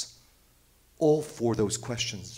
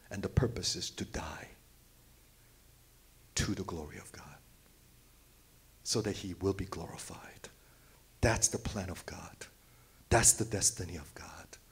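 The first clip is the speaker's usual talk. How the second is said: soft, lower, reverent hush; solemn whisper; 4 semitones lower, 7 dB quieter than usual. Real, not acted. Preacher telling congregation the purpose of dying, whispered. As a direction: breathe it out like a secret.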